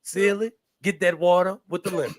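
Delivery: lightly voiced gruff husband; a hint of a stern old Southern man; Mister from The Color Purple. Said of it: "That's Mister from, Mister from The Color Purple".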